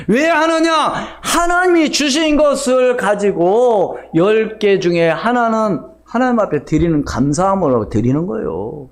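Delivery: high, emphatic pulpit voice; forceful preaching. Acted. Preacher explaining tithing as thanks to the congregation, forceful preaching. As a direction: proclaim it, starting high and insistent.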